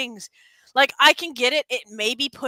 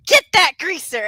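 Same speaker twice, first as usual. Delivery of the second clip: quoting a shout; 6 semitones higher, 9 dB louder than usual. Retold or imitated. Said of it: "where Indy's like".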